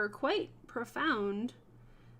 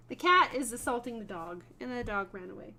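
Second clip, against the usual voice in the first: ordinary talk, nothing marked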